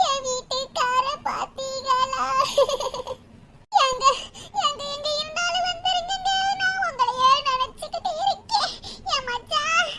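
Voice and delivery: soft, slow sing-song wail for a missing husband; crying and half-chanting in grief; weeping lament. Acted. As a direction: sob softly, drawing out each call. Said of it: "ஏம்மா இங்க உட்கார்ந்து அழுதுகிட்டு இருக்கீங்க".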